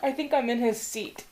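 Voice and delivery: ordinary talk, nothing marked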